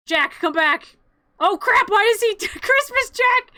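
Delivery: loud, high squawk of dismay; laughing alarm. Real, not acted. Storyteller finding the wrong Jack, shrieking with laughter. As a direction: cry out in startled, laughing disbelief.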